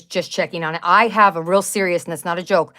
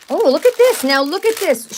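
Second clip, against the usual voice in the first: excited; 8 semitones higher, 4 dB louder than usual. Real, not acted. Excited at opening the package.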